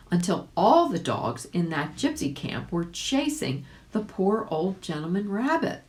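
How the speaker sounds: ordinary delivery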